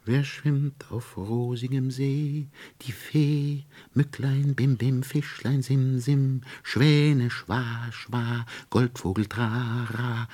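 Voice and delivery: high, lilting sing-song chant; light high fairy chant; silvery little voices. A narrator's reading. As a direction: croon it like tiny silver voices. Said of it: "als sängen zwei holde, feine Silberstimmchen".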